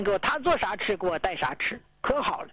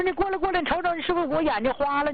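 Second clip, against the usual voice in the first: higher and fast, hurrying her husband over; urgent call; 7 semitones higher, faster than usual. Retold or imitated. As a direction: wave him to the window in a rush. (急着招呼老伴儿过来看)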